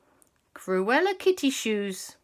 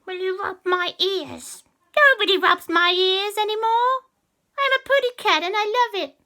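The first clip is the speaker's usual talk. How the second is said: high cat witch; 13 semitones higher, 7 dB louder than usual. Acted. Cat witch demanding a favour from the children, high and sly.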